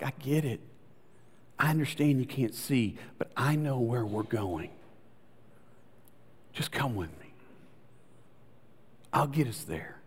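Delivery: low, soft, patient comfort; quietly sure guide; gentle reassurance. Acted. Voices God reassuring doubtful Sarah.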